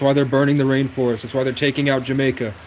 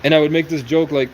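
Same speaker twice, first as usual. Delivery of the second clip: ordinary talk, nothing marked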